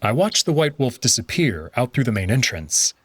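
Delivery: usual speaking voice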